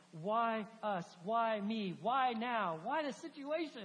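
mock anguished pleading; exasperated questioning. Acting out complaint at his circumstances.

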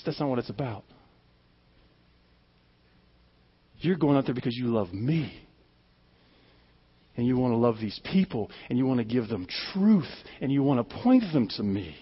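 low, hushed voice of God; God gently correcting. Retold or imitated. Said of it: "God's like".

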